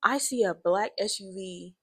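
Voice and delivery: plain reading voice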